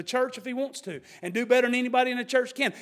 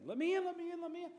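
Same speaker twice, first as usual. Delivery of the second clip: begging; 8 semitones higher, 11 dB quieter, faster than usual. Acted. Foolish virgins begging outside door.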